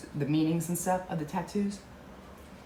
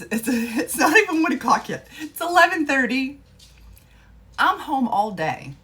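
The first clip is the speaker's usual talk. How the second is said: excited; 11 semitones higher, 11 dB louder than usual. Real, not acted. Tipsy, laughing excitedly.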